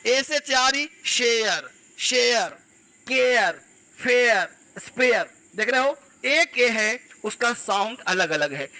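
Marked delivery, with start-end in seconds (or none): 1.1-5.3 शेयर, केयर with EH gliding into a falling YAR; EYA sound. Shown as a demonstration. Teacher drilling A as eya, separated falling words. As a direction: say फेयर, स्पेयर singly, drawing out the glide. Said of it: "ए मतलब एया".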